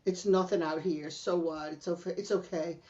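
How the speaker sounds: low, soft, nonchalant tough guy. Retold or imitated. Tough guy brushing off the danger, low and quiet. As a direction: imitate an unbothered tough guy, voice dropped. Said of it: "He's all tough guy".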